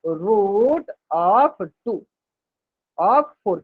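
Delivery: teacher dictating slowly to students; slow dictation. Shown as a demonstration. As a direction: square root of four, drawn out very slowly. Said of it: "इसको कैसे लिखेंगे".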